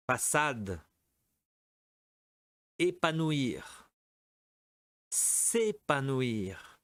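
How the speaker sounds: each word spaced and clearly said for the class; slow, careful teacher model for repeating; model pronunciation. Shown as a demonstration. Teacher giving words for students to repeat aloud. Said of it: "Répétez".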